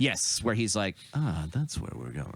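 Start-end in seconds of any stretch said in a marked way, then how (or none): 1.1-2.3 low, soft menacing villain; lightly voiced sinister villain. Quoting villain in post-credit scene.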